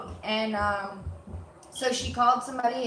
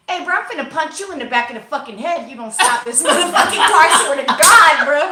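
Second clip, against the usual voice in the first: loud furious threat with a laugh breaking in; retelling a shouted threat at the driver; angry threat; 2 semitones higher, 11 dB louder than usual. Retold or imitated. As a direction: yell at him to stop the car or else. Threatening driver to stop car.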